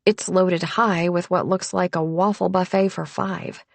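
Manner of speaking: usual speaking voice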